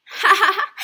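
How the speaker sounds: loud high teasing laugh; mocking laugh. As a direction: laugh at the fooled farmers. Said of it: "指着他们笑说". (嘲笑)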